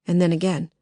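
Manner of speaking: usual speaking voice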